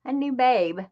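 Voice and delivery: usual speaking voice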